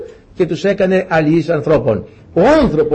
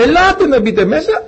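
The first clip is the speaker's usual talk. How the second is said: high-pitched inviting call; 10 semitones higher than usual. Acted. Noah urging people into the Ark, calling out. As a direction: call them in, pitched well up.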